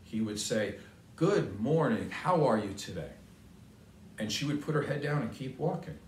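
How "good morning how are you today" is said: usual speaking voice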